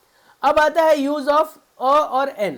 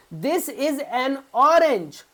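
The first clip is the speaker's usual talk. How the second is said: slow example read; much slower than usual. Shown as a demonstration. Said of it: "teacher reads example slowly".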